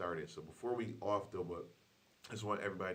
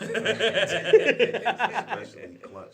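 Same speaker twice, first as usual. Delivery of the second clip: laughing; 19 dB louder, much slower than usual. Real, not acted. Laughing at hard-headed children.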